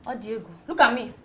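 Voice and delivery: ordinary talk, nothing marked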